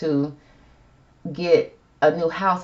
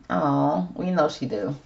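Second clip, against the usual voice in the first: ordinary talk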